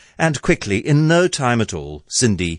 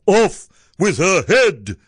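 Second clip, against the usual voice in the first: high, forceful royal command; raised, drawn-out battle cry; roar; 10 semitones higher, 4 dB louder than usual. A narrator's reading. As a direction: roar the order as an enraged prince. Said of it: "the prince roared back".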